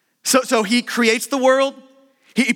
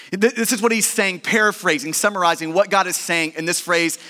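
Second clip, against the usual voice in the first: ordinary talk, nothing marked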